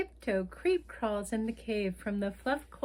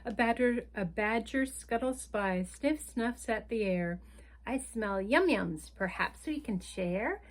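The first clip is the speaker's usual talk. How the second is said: lively picture-book read-aloud; reading to children. A narrator's reading. Storyteller reading a picture book to children, lively. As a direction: read the story like bedtime for little ones.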